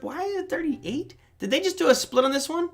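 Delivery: high-pitched, puzzled questioning; puzzled surprise. Real, not acted. Host spots an odd chart price, puzzled surprise. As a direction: pitch jumps up in real bafflement.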